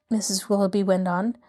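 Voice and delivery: usual speaking voice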